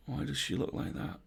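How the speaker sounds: put-on frightened boy's whisper; hushed, scared whisper from the chair. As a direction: grandson whispering in fear. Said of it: "whispered her grandson".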